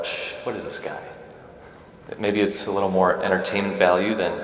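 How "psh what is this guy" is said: a whispered, scoffing aside; dismissive scoff. Retold or imitated. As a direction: brush him off under your breath. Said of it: "you're probably like".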